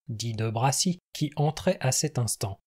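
plain reading voice

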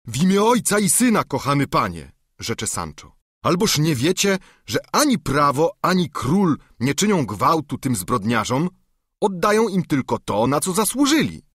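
an alarmed squire pleading with his master; excited Sancho. Acted. (Sancho protestuje wyżej i głośniej)

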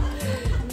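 high-pitched laugh at own joke. Real, not acted. Presenter joking that they explained it really well, high laugh.

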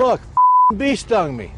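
high, slow, seething pain; angry in pain. Real, not acted. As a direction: grind it out after a sting. Stung by bee live.

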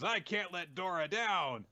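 high, slow melodramatic cry; mild anime-hero resolve; determined hero. Retold or imitated. Kusanagi refusing to fail Dora, high earnest outcry. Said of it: "Kusanagi just goes".

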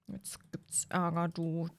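ominous little whisper; conspiratorial whisper to a brother. Acted. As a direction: breathe the warning, no voice. Warning brother before Mom arrives.

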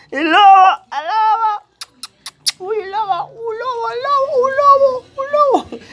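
very high squealed, howling cries; playful wolf howl. Pretend. Owner calling puppy a wolf on camera, high playful howling.

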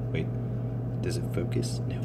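unvoiced muttered question; hushed puzzled aside; whispered question. Real, not acted. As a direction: whisper it to yourself while checking the camera.

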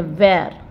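teacher contrasts wear; pronouncing wear. Shown as a demonstration. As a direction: say wear as the other word. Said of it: "Wear means पहनना".

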